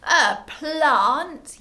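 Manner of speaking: high, loud cry of horror; horrified yell. A narrator's reading. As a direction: yell it like an appalled mum. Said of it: "yelled Mum in horror".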